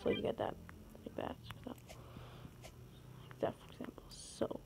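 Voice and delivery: breathy whispered aside; hushed whispered explanation. Real, not acted. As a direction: whisper while demonstrating the jump. Whispered aside while showing.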